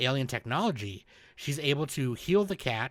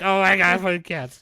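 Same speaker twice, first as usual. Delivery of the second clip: loud, higher-pitched fangirl burst; geeking out; 5 semitones higher, 8 dB louder than usual. Retold or imitated. Cat owner geeking out for the alien girl, excited gushing. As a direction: gush in mock-ecstatic excitement. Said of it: "I'm geeking out for her".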